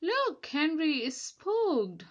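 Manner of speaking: rude laughing. A narrator's reading. Truck mocking Henry for being spooked, rude laughing jeer. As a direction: jeer at him rudely. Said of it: "laughed a truck rudely".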